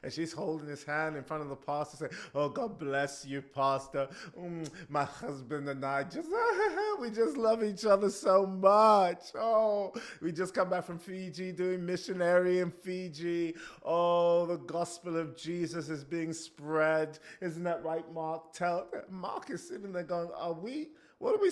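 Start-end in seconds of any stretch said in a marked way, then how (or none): 2.3-9.2 loud, high, gushing churchy wife voice; fake sweet wife. Wife showing off her marriage to the pastor, gushing and loud. 9.3-18.9 loud, high, gushing churchy wife voice; fake sweet wife. Wife showing off her marriage to the pastor, gushing and loud. Wife keeps the pious act.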